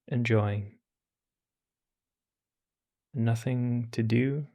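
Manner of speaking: calm, unhurried meditation voice with long pauses; guided meditation. Acted. Teacher guiding listeners through meditation, calm and unhurried. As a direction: ease listeners into stillness.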